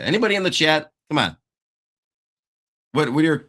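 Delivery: ordinary talk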